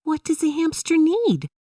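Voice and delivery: put-on high, curious young girl; Emily's voice. A narrator's reading. Emily asks her dad about hamsters, high girlish voice. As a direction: ask it like a wide-eyed kid. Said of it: "Emily asked".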